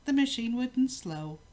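ordinary delivery, nothing marked

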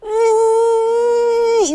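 위 held as one long, high, unchanging vowel; sustained vowel demo. Shown as a demonstration. Teacher models the ü vowel for Korean learners, sustained demo. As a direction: sound out 위 as a single drawn-out ü. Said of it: "한국의 위라는 발음이 있어요. 걔랑 달라요".

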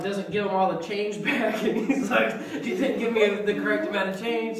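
usual speaking voice

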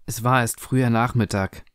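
plain reading voice

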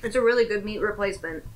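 chewing while talking; talking between bites; mouth full. Real, not acted. Seen 0: Burger held at mouth, biting before and after; jaw working while talking.

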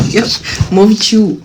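usual speaking voice

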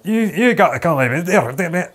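loud, high mock Scottish gabble; exaggerated imitation of thick Scottish; garbled Scottish imitation. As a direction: gabble it in an incomprehensible Scottish accent. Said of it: "This guy's just, just like".

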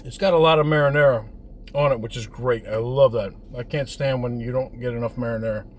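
chewing while talking; speaking between bites; mouth partly full. Real, not acted. Seen 1: Bit slice at -5 to -2, lips still working, holding pizza.

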